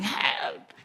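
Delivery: half-voiced mock scream; mock scream for help. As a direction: act out a scream without full volume. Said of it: "you are really screaming".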